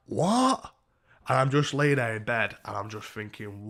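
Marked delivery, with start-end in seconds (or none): 0.1-0.7 high, rising incredulous question; baffled disbelief. Retold or imitated. Reacting in bed to her claim, baffled. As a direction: squeak it out, utterly thrown.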